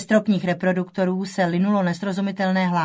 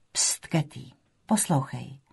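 soft, low shushing; gentle hushing; 5 semitones lower, 6 dB quieter, slower than usual. Acted. Mother hushing daughter so she will listen, softly hushed. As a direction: hush the listener quietly, voice dropped low.

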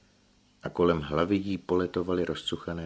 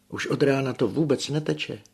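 panicked; 10 semitones higher, 5 dB louder than usual. Acted. Igolén panics, breathless.

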